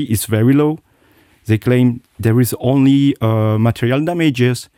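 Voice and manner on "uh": ordinary delivery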